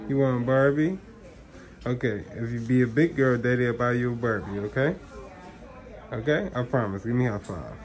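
soft coaxing. Real, not acted. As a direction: soften it and promise gently. Soft promise to toddler.

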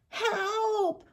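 high, thin wail trailing into soft whimpers. A narrator's reading. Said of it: "he wailed again".

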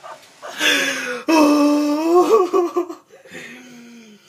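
high crying laughter; wheezing laugh fit. Real, not acted. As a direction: laugh till you cry.

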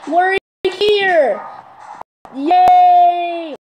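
high, loud cry of joy; excited cheer. Real, not acted. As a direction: cheer loudly at finally arriving. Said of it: "I'm so getting real excited".

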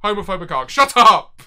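ordinary delivery

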